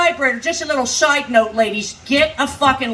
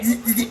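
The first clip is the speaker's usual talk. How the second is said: buzzing vibrator noise; 4 semitones lower, 6 dB quieter, much faster than usual. Retold or imitated. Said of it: "dying vibrator buzz".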